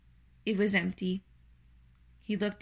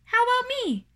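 very high, loud little voice; high squeaky creature question; third little spider; 6 dB louder than usual. Acted. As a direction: pipe up eagerly as a tiny spider.

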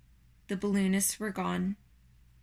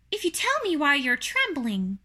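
raised little spider voice; curious small creature, pitched up; first little spider; 5 semitones higher, 4 dB louder than usual. Acted. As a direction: ask as a little spider, higher than usual.

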